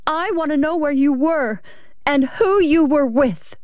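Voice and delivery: high, insistent demand; angry wife. Acted. Wife gripping her husband's arm, angry and high.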